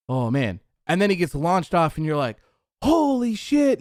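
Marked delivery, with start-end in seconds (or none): 2.8-3.8 high, loud burst of disbelief; shocked fan yell. Retold or imitated. A fan watching Foley launched off the cell, yelling in shock. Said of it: "You're screaming".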